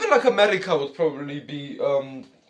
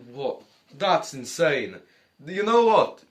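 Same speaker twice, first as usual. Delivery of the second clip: normal talking voice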